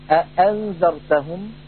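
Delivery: أأنذرتهم with the second hamza softened between hamza and alif; slow teaching demo of أأنذرتهم, second hamza eased; أأنذرتهم recited slowly, second glottal stop half-voiced; easing the second hamza. Shown as a demonstration. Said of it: "يقوله".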